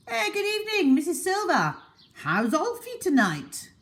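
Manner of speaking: put-on high, loud shy old man calling out; hammed-up timid old gentleman; Mr Hoppy calling. A narrator's reading. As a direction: call down politely to the neighbour. Mr Hoppy calls down to neighbour.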